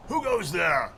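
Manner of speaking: loud, high challenge from a guard; sentry calling out. Acted. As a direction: call out to strangers at night. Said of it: "calls out".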